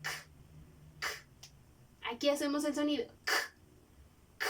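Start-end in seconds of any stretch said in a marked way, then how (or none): 0.0-1.1 repeated "k" hisses like a cat. Shown as a demonstration. Teacher to pupils, hissing like cats. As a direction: hiss "k, k" like a cat. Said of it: "como cuando le hacen los gatos".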